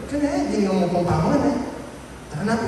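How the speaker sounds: high playful voice for the friend; lightly voiced friend coaxing you to eat; mock friendly invite. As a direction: pitch up into a coaxing buddy. Voicing friend offering food.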